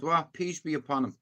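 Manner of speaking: ordinary delivery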